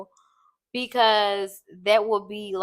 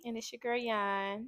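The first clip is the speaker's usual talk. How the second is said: soft, much quieter sultry intro; mock sexy; 13 dB quieter than usual. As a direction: purr the name like a seductive host. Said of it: "Why are you trying to sound sexy?".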